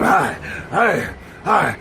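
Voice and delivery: short, repeated mock grunts; lightly imitated caveman sex sound; caveman grunting. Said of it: "Sound like a damn caveman".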